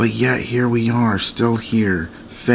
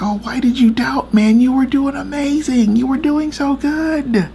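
the Lord's mock exclamation; 13 semitones higher than usual. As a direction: exclaim it high, like an exasperated cheerleader. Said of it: "But the Lord's like".

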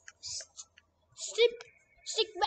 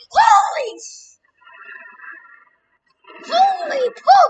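high startled yell; surprised shout; 9 semitones higher, 11 dB louder, slower than usual. Real, not acted. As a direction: yell out in shock. Lightning bolt kills character.

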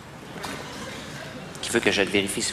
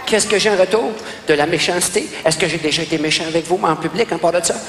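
higher-pitched, aggrieved questions; wounded protest; 7 semitones higher, 3 dB louder than usual. Acted. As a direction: plead your case as the injured party. Wounded man protests his treatment. (protestation d'homme blessé)

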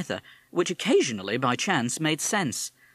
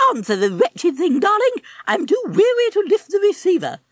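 high affected toff complaint; languid posh parrot; 10 dB louder than usual. Acted. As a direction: play a bored upper-class twit. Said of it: "the affected way he spoke".

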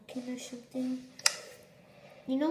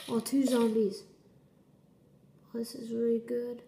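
ordinary delivery

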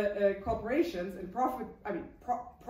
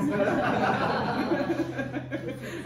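laugh; 3 semitones higher, 6 dB louder than usual. Real, not acted. Laughs at cheap London house.

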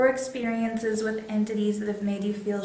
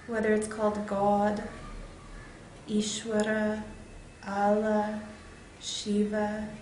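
guided meditation; 3 dB quieter than usual. Real, not acted. Meditation leader guiding viewers toward love and light, guided meditation. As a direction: guide them inward with long pauses between phrases.

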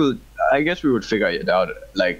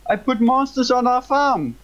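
panicked gamer; 14 semitones higher than usual. Real, not acted. Panicking at monsters in game.

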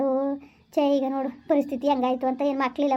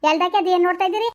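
higher, louder barked orders; angry shout; 4 semitones higher, 5 dB louder than usual. Acted. Mother yelling at the relatives to leave, angry shout. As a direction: yell at them to get out.

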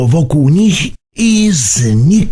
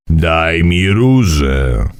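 low, slow stern demand; low sharp command from an old man; 7 semitones lower, slower than usual. A narrator's reading. Mimo sharply repeats his demand to Burbi, low and stern. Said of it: "powtórzył Mimo ostro".

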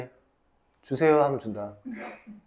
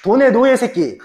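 mock scorn; 8 semitones higher, 7 dB louder than usual. Mocking students clapping for money.

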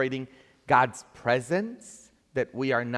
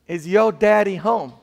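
lightly put-on southern accent, raised pitch; southern accent, louder and higher; 6 semitones higher, 8 dB louder than usual. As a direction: ask it in a preacher's Southern accent. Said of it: "This is my southern accent".

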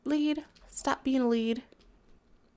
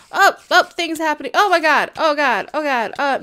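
panicking as things go wrong; panicked yelling; 2 semitones higher, 11 dB louder than usual. Real, not acted. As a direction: shout in a panic.

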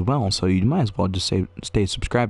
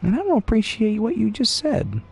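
mock offended voice; 12 semitones higher than usual. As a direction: pretend hurt feelings. Scolding on behalf of items.

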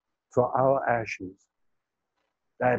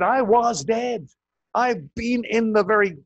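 booming proclamation; 8 semitones higher, 6 dB louder than usual. Acted. Proclaiming the resurrection.

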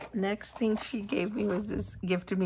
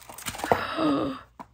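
delighted gasp; 6 semitones higher than usual. Real, not acted. Gasp at Disney die.

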